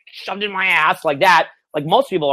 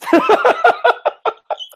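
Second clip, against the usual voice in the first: high burst of laughter; 4 dB louder than usual. Real, not acted. Laughing at own impression.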